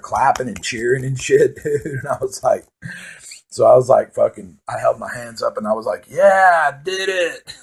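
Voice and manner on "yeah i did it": triumphant cheer. Retold or imitated. Triumphant arms up at slide end.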